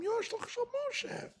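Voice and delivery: mock the messenger of Moshe in a high sneer; taunting falsetto-like put-down. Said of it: "I'm adding a little bit of inflection".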